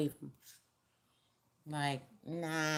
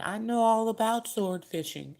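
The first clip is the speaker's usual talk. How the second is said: know-it-all husband, raised higher and louder; mock know-it-all husband; 6 semitones higher, 7 dB louder than usual. Reader mimics husband boasting, in a mock know-it-all voice.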